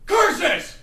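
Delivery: furious shouting villain; villain rant. Acted. Villain ranting at window.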